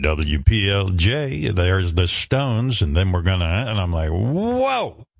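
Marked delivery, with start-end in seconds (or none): 0.0-3.5 low, slow velvet radio patter; imitating a silky seventies radio jock; smooth DJ voice. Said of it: "this guy's like". 4.1-5.1 awed whoa. Real, not acted. Awed reaction to the DJ.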